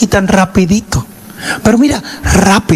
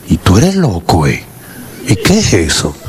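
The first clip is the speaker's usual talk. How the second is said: low shocked voice; 8 semitones lower than usual. Retold or imitated. Speaker shocked at cousin.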